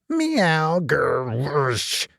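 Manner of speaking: yowl, growl and hiss like a night cat; horrid cat noises. Acted. Simpkin answering the tailor's sleep-talk, strange cat noises. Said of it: "made strange, horrid noises".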